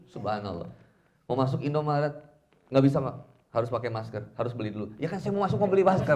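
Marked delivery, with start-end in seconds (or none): 5.0-6.1 high, loud customer complaint; exasperated protest. Acted. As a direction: build into an indignant, raised-pitch plea. Acted customer protesting at the shop.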